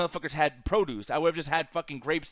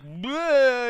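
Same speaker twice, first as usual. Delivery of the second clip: loud, higher, drawn-out nonsense noise; addled bleh; 7 semitones higher, 6 dB louder than usual. Retold or imitated. Teller acting the worker confused by salt, addled blurt. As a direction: blurt a mock brain-scrambled bleh.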